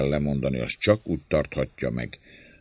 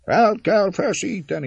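terrible echo; 5 dB louder than usual. A narrator's reading. Whole mob repeating the cry, echoing shout. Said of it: "ismétli a szörnyű visszhang".

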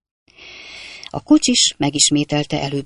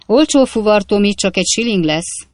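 gruff shout; 5 semitones higher, 5 dB louder than usual. A narrator's reading. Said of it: "kiáltott oda a kocsis mogorván".

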